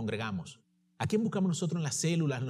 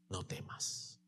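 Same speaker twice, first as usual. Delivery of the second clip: whispered "No temas", barely audible; tender whisper; 6 semitones lower, 14 dB quieter, slower than usual. Acted. Jesus speaking to the preacher himself, whispered tenderly. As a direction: let it fall like a gentle reassurance. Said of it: "Y escucharlo decirme".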